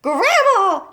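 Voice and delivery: high, loud shriek of Grandma. A narrator's reading. Missus Cranky shrieking at Grandma, high and loud. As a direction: shriek in panic. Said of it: "shrieked Missus Cranky".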